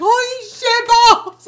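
high, slow drawn-out gasp of fright; scared exclamation, hammed up; mock terrified. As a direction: stretch it out like you just cheated death. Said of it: "I was like".

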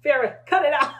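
mock boss telling off an employee; scolding boss. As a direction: snap at the worker like a bossy manager. Boss scolding worker in example.

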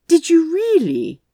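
high amused disbelief; surprised. Acted. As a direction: gasp it out, delighted. Aziraphale's amused reaction.

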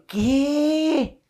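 high, drawn-out "what?"; retold shocked reaction. To himself on hearing the boyfriend's bike, stunned disbelief.

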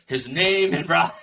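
high mock chant from the film; playful film-quote voice, breaking into a laugh; Fight Club imitation. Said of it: "it's like Fight Club".

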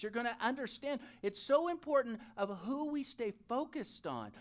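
ordinary talk, nothing marked

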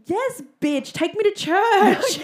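high reaction building into a loud, drawn-out cry; hyped-up hallelujah. Retold or imitated. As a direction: whoop it like a fired-up congregation member. Acting out own hyped reaction.

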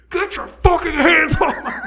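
high, slow mock yell on the mic; mock bartender telling her off, laughing; angry bartender. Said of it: "this dude gets to the microphone and is like".

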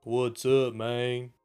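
hammed-up Southern drawl; mock Southern accent. Europeans doing American accents, mock Southern. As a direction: imitate a foreigner faking a Southern accent. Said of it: "or the Southern".

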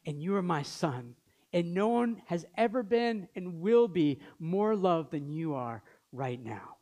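ordinary talk, nothing marked

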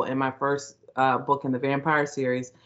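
usual speaking voice

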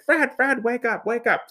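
high, urgent repeated calls; imitated waking call. Grandmother waking Fred from a snore, imitated urgent calling. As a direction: call out as if rousing a sleeper, pitched high. Retells waking husband from snoring.

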